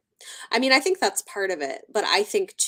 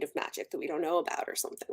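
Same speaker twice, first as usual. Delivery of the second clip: whisper; 4 semitones lower, 9 dB quieter, much faster than usual. Real, not acted. Wondering aside.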